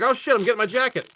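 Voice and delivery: high, quick eager reply; mock eager viewer. Viewer reacts to swearing forecast, high and quick. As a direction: jump to obey the forecast. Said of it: "I'm like".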